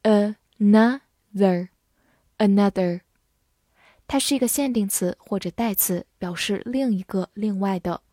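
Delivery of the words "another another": slow, careful another, tongue between teeth on th; slow read. Shown as a demonstration. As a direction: stretch out another for learners. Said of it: "注意咬舌". (慢速示范another)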